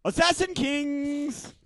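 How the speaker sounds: high, slow, showy announcement; announcer call. Acted. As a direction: sing out the company name. Answering as assassin character.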